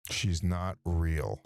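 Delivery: very slow, deadpan jab. Acted. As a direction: drag it out, deadpan. Deadpan jab about his wife.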